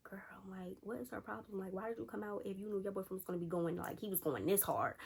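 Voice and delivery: hushed, gossipy aside to a friend; confidential whisper. Retold or imitated. Selena confides in the storyteller about their friend, whispering. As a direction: imitate a friend whispering behind someone's back.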